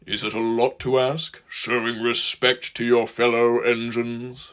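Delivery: slow, grave engine voice; solemn and weighty. A narrator's reading. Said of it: "asked Gordon gravely".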